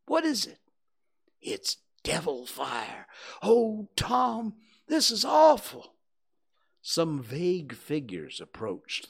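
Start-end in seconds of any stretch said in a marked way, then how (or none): 0.1-5.9 high hushed fearful cries; frightened. Acted. Huck spotting devil fire, high frightened whisper. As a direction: terror in a whisper.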